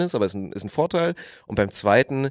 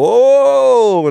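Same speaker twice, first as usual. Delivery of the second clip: high, loud impressed oh; crowd oh; 13 semitones higher, 10 dB louder than usual. Retold or imitated. Onlookers reacting to a juggling trick, loudly impressed. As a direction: wow at the trick. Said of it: "Und dann alle so".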